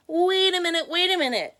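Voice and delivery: high, loud interruption; loud, high-pitched alarm from the narrator; urgent cry. Acted. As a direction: cry out to halt everything. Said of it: "cried Jack the narrator".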